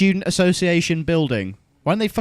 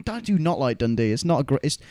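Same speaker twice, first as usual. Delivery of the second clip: ordinary delivery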